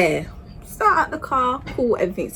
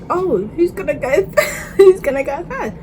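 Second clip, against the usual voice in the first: giggly question; 7 semitones higher than usual. Retold or imitated. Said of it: "they're like".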